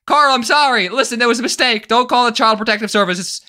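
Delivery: high, hammed-up begging; mock panicked pleading. Dad begging Carl over child services, mock panic. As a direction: plead frantically, playing it up.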